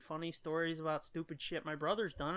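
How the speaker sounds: ordinary delivery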